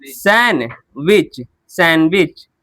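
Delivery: "सैंडविच" said as SAN-witch, first split slowly, then joined; "सैंडविच" going from san straight to witch; silent D. Shown as a demonstration. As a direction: drop the D in "सैंडविच", syllables apart then together. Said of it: "तो ये हो गया सैन".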